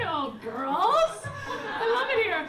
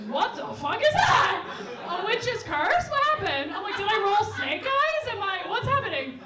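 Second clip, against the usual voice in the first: loud, high-pitched disbelief; exasperated, raised-voice bewilderment; baffled outrage; 7 semitones higher, 6 dB louder than usual. Retold or imitated. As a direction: cry out in comic alarm at absurd bad luck. Acted outrage at bad luck.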